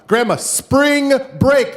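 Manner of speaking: very high, loud, drawn-out words; exasperated yell. Retold or imitated. Grandson yelling final time at deaf grandma, slow high yell. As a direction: bellow it slowly, word by word.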